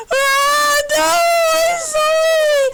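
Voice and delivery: a rejected robot throwing a fake tantrum; mock sobbing. Robot rejected, melodramatic crying.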